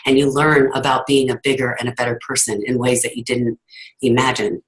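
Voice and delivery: normal talking voice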